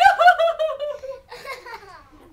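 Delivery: high, loud theatrical sobbing; mock crying. Mama monkey who lost her baby, loud mock wailing. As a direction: bawl as the mama monkey, hammed-up. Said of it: "And she cried".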